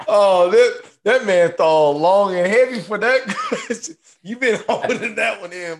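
laughing through the words; high, loud laughing voice. Real, not acted. Laughing at friend's answer.

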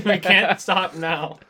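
slow, loud words through laughter; laughing too hard to stop. Real, not acted. Laughing at absurd answers.

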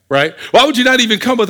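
ordinary talk, nothing marked

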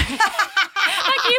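high laughing thank you; giggling, high-pitched thanks. Real, not acted. Laughing thanks after joke.

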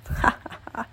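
soft breathy wicked chuckle; wicked laugh. Acted. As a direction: snicker wickedly. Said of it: "he laughed wickedly".

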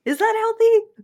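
real surprise at the idea; surprised disbelief. Real, not acted. Surprised question.

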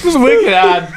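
mock Boston local; Boston accent. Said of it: "I love Boston".

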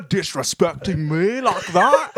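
high, loud, slow hammed-up Scottish accent; mad Scottish guy. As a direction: badly fake an outraged Scottish accent. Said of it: "kinda mad".

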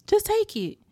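high, impatient mock retort; exasperated. Daughter handing her mother the cup, mock exasperation.

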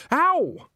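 loud, high "ow"; sudden shrieked cry of pain; pained yelp. A narrator's reading. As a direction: yelp as if kicked mid-sentence. Nanny yelps at a jab.

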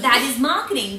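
ordinary delivery

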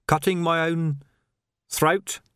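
mildly desperate query. A narrator's reading. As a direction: repeat it haltingly as a question. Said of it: "said Vimes desperately".

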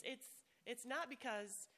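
ordinary talk

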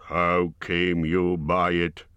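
ordinary delivery, nothing marked

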